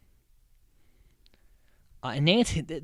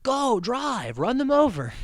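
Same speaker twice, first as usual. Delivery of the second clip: much higher, louder, cackling order; high gleeful mock order; 10 semitones higher, 5 dB louder than usual. The Guidos urging their cabbie on, high and gleeful. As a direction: urge the driver on in a high manic voice. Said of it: "the Guidos just, like".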